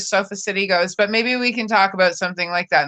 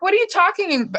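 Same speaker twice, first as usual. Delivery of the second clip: high confused voice; 10 semitones higher than usual. Retold or imitated. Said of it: "and I'll be like".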